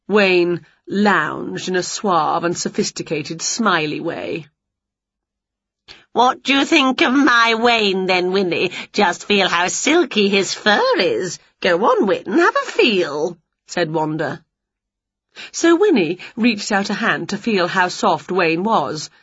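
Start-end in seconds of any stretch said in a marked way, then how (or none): 6.1-13.4 a lightly voiced smug witch showing off her cat; smug rival witch. A narrator's reading. Wanda boasting to Winnie about her cat, lightly smug. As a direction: preen a little, proud of Wayne.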